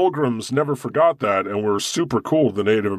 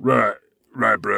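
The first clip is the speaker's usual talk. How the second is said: bro voice; 4 semitones lower than usual. Pretend. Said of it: "You have a good American accent".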